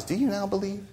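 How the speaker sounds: irony mixed with sadness. Acted. Jesus to the overconfident disciples, soft and sad. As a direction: let "Do you now believe?" fall away, soft and sorrowful. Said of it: "irony mixed with sadness".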